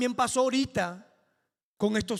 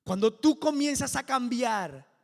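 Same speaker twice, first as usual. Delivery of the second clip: builds from firm to much louder, higher preaching; full-force preaching; 6 semitones higher, 11 dB louder than usual. Acted. Preacher rousing the congregation toward their miracle, building to full-force preaching. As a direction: preach it to a roaring climax.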